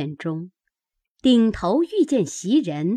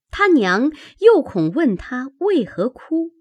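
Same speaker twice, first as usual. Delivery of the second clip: ordinary delivery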